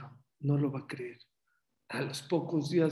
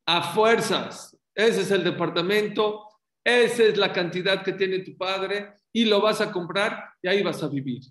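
firm ruling; 6 semitones higher, 7 dB louder than usual. Retold or imitated. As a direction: lay down the law like a rabbi. The rabbi's firm ruling quoted. (el rabino ordena con fuerza y en voz alta)

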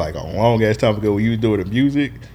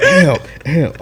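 higher-pitched imitated yelp; excited calling out; 6 semitones higher than usual. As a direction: mimic someone eagerly calling for attention. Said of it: "He's excited, bro".

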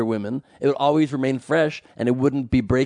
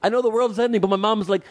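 higher, lightly imitated Filipino accent; 8 semitones higher than usual. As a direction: mimic a friend with a Filipino accent. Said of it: "Joe somehow finds a way to do a Filipino accent".

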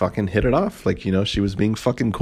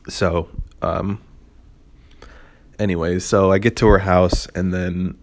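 usual speaking voice

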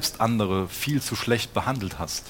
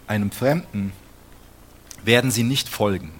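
louder, higher solemn Bible reading; scripture reading; 3 semitones higher, 5 dB louder than usual. Acted. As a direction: proclaim the verses to the congregation. Reads Bible passage aloud. (laut und feierlich vorgelesene Bibelstelle)

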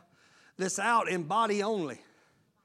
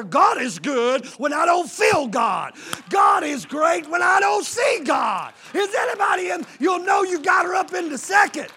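preaching at full force; 8 semitones higher, 9 dB louder than usual. Acted. Preacher shouting praise at the climax. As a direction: declare it over the crowd.

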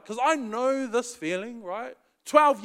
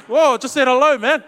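mock startled; 6 semitones higher, 14 dB louder than usual. Mock reply to guilty churchgoer.

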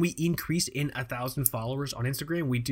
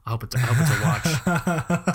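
laughing. Real, not acted. Joking about the package, laughing.

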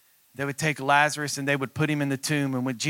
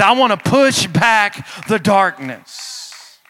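high, loud, drawn-out preacher's cry; booming declaration, stretched and raised in pitch; shouted rallying cry; 7 semitones higher, 10 dB louder, slower than usual. Real, not acted. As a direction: belt it out slowly to rouse the congregation. Rallying cry at sermon's peak.

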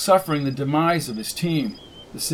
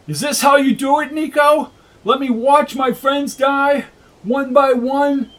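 anguished yell; 10 semitones higher, 5 dB louder than usual. Acted. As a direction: rage at the heavens. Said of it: "yelled at the sky".

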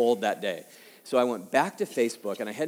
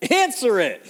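sudden shouted order, much higher and louder; retold yell as she stands over the desk; wife yelling; 13 dB louder than usual. Wife yells at husband to take the call, loud urgent shout. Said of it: "And my wife yells".

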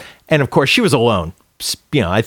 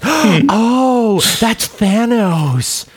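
much higher, breathless oh-so-shocked woman; mocking gasping moviegoer; 10 semitones higher, much slower than usual. Mocking the woman who gasps at every scene. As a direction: ham up a gasping chatterbox in the seats.

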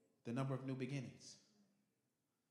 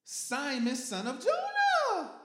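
much higher, loud, slow call like a scolding mother; full-name call out; 14 dB louder, much slower than usual. Acted. Jesus calling Peter out by full name, loud. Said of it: "your mama called your full name".

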